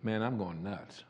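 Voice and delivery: soft, slow worried mutter; half to yourself, doubting your sanity; muttering to himself. Retold or imitated. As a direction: driver muttering he's losing his mind. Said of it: "you think you're hearing voices".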